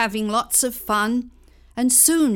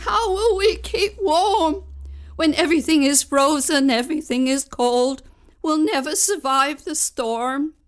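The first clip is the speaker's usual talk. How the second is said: frightened cry; 5 semitones higher, 6 dB louder than usual. Acted. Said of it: "peeked outside and cried".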